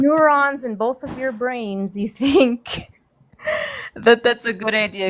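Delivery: openly sarcastic, incredulous questioning. Real, not acted. Panelist mocking two people's brilliant minds, openly sarcastic. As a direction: lay the mock praise on thick. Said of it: "Excuse my sarcasm".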